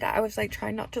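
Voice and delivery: ordinary delivery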